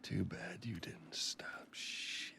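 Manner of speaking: slow, spiteful whisper. Acted. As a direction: hiss the last words out. Defeated villain's last bitter words.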